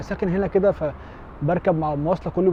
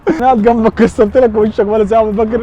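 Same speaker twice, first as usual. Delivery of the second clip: laughing loudly; 4 semitones higher, 12 dB louder than usual. Real, not acted. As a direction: speak louder while still laughing. Prankster laughing, reveal. (بصوت عالي وهو بيضحك)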